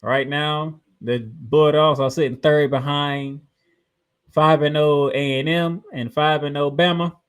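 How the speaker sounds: ordinary talk, nothing marked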